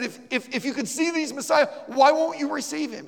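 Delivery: high and loud pressing question; urgent question. Retold or imitated. The preacher presses her why she refuses, high and loud. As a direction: push her for an answer.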